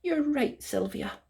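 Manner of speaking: a touch of tearful, high admission; mild tearful. A narrator's reading. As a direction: give in sadly. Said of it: "Tears filled Rapunzel's eyes".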